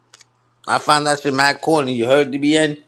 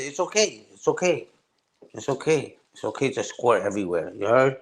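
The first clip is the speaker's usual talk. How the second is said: softer, mock-soothing; mock reassurance; 6 dB quieter than usual. Mock-comforting O G to chat, softly soothing. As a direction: pretend to comfort, quietly.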